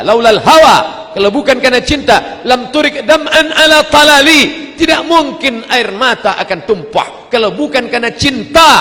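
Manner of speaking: loud, high-pitched declamation; impassioned preaching. Real, not acted. Preacher translating a poem about love and tears, fervently. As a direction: cry the verse out at full force.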